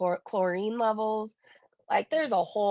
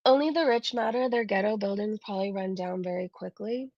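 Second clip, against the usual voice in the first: ordinary delivery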